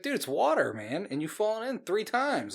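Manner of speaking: high, exasperated retold scolding; mock incredulity. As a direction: act baffled at a friend's needless panic. Retold scolding of screaming friend.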